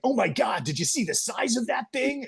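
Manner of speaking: high-pitched, over-the-top American gushing; hammed-up American movie-character amazement; mock American accent. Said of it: "you get so used to the accent where it's just like".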